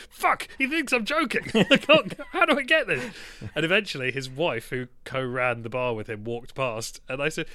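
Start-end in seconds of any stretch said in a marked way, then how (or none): 0.1-3.1 higher, louder frustrated inner voice; hammed-up panic at being ignored; mock exasperation.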